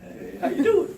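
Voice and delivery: high, loud perky greeting; comic high-pitched friendliness; cheery Target employee. Acted. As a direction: greet like a chirpy store worker. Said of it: "people were like".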